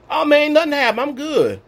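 breezy carefree voice. Retold or imitated. Voicing a carefree churchgoer.

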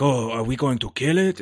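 lightly imitated Japanese accent. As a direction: mimic the movie dad with a Japanese accent. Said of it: "if any of my accents were insensitive".

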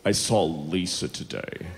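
low, soft, slack dude voice; dopey guy. Retold or imitated. Comedian mimicking the friend's news about Lisa, slack and dopey. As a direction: mumble it low like a lazy dude. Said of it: "So his friend goes".

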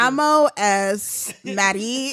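slow, halting beginner Spanish; bad Spanish accent. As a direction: sound out clumsy tourist Spanish. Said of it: "you're gonna sound like Rudy Giuliani".

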